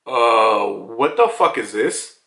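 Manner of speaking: low, slow mock disbelief; acted bafflement. Voices Lucci's baffled reaction.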